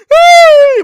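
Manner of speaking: high loud yell; screaming hype; hyped shout. Real, not acted. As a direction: shout it out in excitement.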